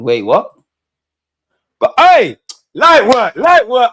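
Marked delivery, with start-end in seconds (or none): 1.8-3.9 high, loud gleeful chant; celebrating. Real, not acted. Fan cheering the second goal, loud and gleeful. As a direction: gloat over an easy win.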